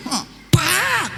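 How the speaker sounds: short mock karate yell; karate shout. Preacher imitating the teacher's strike with a mock yell. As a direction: bark it like a martial arts kiai.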